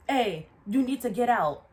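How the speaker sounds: loud, rude order to leave; rude yelling. Retold or imitated. Coworker ordering a maskless customer out, loud and rude. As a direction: bark him out the door, mimicking a rude coworker. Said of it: "so rude and aggressive about it".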